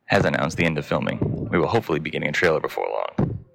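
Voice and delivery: low, even newsreader voice; news read. Acted. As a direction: read the headlines like a news anchor.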